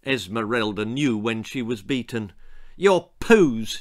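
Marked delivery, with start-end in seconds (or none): 2.8-3.8 loud, higher, mildly sulky retort. A narrator's reading. Beaten daughter gives in to parents, sulking. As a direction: give in with a sulk. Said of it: "she sulked".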